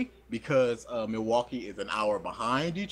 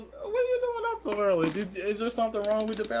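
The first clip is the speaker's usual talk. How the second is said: put-on very high surprised girl; girl's voice; 9 semitones higher than usual. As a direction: squeak it like a startled roommate. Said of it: "So she goes".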